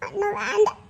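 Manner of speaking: sulky murmur. Acted. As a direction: barely whisper the refusal with a giggle. Wife refusing food very softly. (மெல்லிய சிணுங்கல்)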